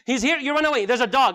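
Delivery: ordinary talk, nothing marked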